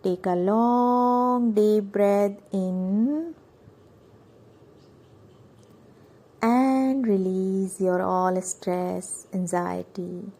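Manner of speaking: very drawn-out, sustained words; slow, intoned breathing instruction; breathing cue. Shown as a demonstration. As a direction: stretch LONG and AND over a whole breath. Cueing breath in and out.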